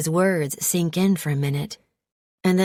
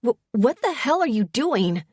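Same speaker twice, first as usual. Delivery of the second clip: higher, stammering shock; startled yelp; 6 semitones higher than usual. A narrator's reading. She yelps when he pins her hand, startled. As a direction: jolt up as the grip catches you. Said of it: "as I yelp in surprise".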